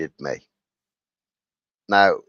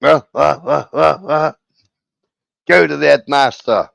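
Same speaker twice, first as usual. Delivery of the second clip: higher, louder scolding teacher; mock outrage of a teacher catching a cheat; ah ah ah then send him off to the headmaster, higher and louder; teacher's scolding; 6 semitones higher, 6 dB louder than usual.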